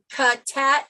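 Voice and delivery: "ka-tat" with the K and T traded between words; switched first letters. Shown as a demonstration. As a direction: say "ka-tat", K first and T starting the second syllable. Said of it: "I switch the first letters".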